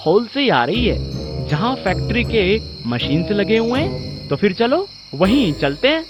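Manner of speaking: hesitant friend character. Acted. Amit answers in acted dialogue.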